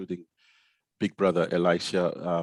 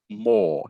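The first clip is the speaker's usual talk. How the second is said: more, punched high and loud; stressed word more; 13 semitones higher, 6 dB louder than usual. Real, not acted. Punning on his wife's name.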